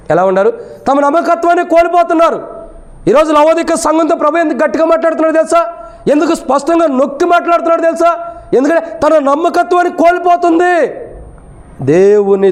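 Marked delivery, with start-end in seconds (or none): 0.9-10.9 high-pitched, insistent sermon delivery; preaching. Real, not acted. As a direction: preach it in a raised, sustained voice.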